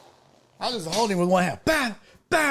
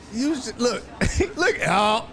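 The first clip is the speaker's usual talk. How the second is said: usual speaking voice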